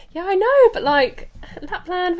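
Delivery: high, chirpy fake cheer; cheery elf voice. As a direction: play the jolly elf. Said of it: "And I was like".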